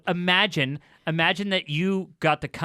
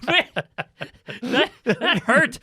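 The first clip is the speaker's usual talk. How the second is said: laughing; 7 semitones higher, 6 dB louder than usual. Real, not acted. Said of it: "you're good".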